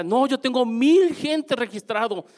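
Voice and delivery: lightly voiced excited woman bragging; proud lady. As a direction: play the thrilled seminar lady. Voicing the lady's proud answer.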